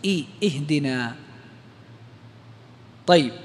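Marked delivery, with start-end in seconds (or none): 0.1-1.2 "اهدنا" opening on a clear "i"; hamza with kasra. Shown as a demonstration. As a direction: sound the i alone, then "اهدنا" as "ihdina". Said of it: "اكسر همزة الوصل". (نطق "اهدنا" بهمزة مكسورة في أولها)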